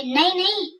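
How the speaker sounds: high, urgent cry of no; shouting no. A narrator's reading. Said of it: "चिल्लाते हुए".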